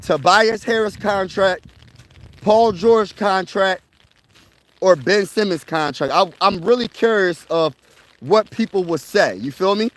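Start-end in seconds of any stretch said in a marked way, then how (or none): 0.0-6.1 poll question. Retold or imitated. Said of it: "a community post and say".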